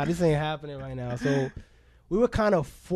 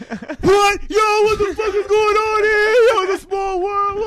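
very high, loud, excited shouting; shocked excitement; 7 dB louder, slower than usual. Retold or imitated. Old friend on the phone recognizing him, shouting excitedly. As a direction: yell out in joyful disbelief.

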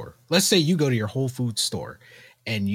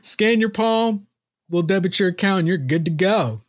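mock cheerful store voice; 8 semitones higher, 5 dB louder than usual. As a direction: sell it like a chirpy store. Mocking store voice, laughing.

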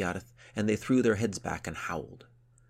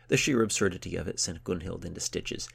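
usual speaking voice

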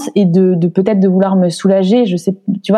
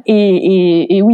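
ordinary talk, nothing marked

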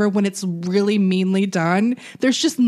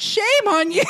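mock outrage; 10 semitones higher than usual. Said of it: "another person said".

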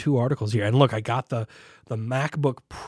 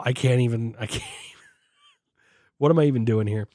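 ordinary delivery

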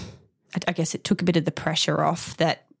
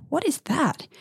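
much higher, startled question; puzzled surprise; 10 semitones higher than usual. Retold or imitated. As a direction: catch yourself baffled. Retold surprise at milk drops.

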